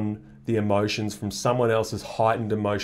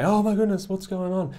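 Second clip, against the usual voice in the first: high-pitched feigned concern; mock alarmed parent; 9 semitones higher than usual. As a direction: fake a startled fuss over the baby. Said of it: "and then you say".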